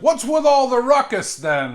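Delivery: high, loud character voice; brash character voice. Acted. Adventurer runs over asking about noise, high loud voice.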